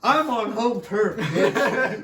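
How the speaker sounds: voicing the father's home-field taunt, laughing; mock dad swagger. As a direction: ham up the father's boast. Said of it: "voicing dad's attitude".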